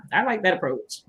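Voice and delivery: usual speaking voice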